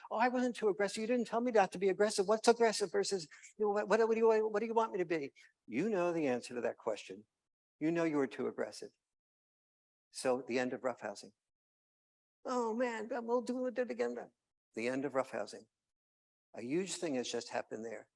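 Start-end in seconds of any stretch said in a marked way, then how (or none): 0.1-5.3 put-on higher, louder whiny kid; protesting kid. Kid protesting to dad, higher and louder. 12.5-14.2 put-on much higher kid groan; disappointed kids. Kids told play is over, much higher.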